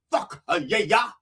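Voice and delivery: "Fuck uh, yeah, yeah" padded with added beats; extra syllables. Shown as a demonstration. Preacher flaunting extra syllables in "Fuck uh, yeah, yeah". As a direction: slip an "uh" in and double "yeah". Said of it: "I got extra syllables".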